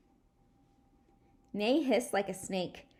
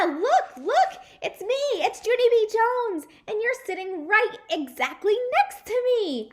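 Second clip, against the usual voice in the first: much higher, louder thrilled shout; excited shout; 14 semitones higher, 9 dB louder than usual. Acted. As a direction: yell it jumping up with joy. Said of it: "I shouted".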